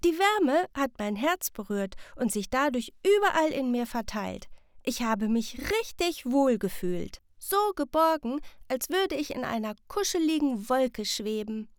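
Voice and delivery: dreamy and cosy; smiling child. Acted. Sam explaining the heart cloud to mother, smiling. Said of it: "Sam antwortet lächelnd".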